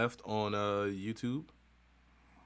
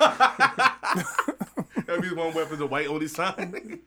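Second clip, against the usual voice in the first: laughing; 6 semitones higher, 7 dB louder, slower than usual. Real, not acted. Laughing at own joke.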